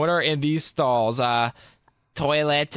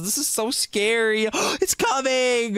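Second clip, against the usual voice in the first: scared; 7 semitones higher, slower than usual. Acted. Fleeing the bowling ball.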